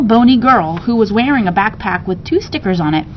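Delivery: ordinary delivery, nothing marked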